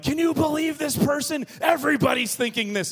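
mock his own overreaction; indignant outburst.

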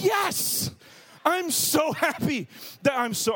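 high, loud burst of relief; gleeful yes. Retold or imitated. Tired friend hearing the plans are cancelled, gleeful and loud. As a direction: cheer like the plans just got cancelled. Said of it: "And you're so happy".

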